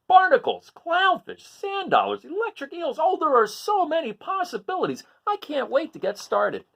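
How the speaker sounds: the crab bursting with decorating ideas; excited Hermit Crab. Acted.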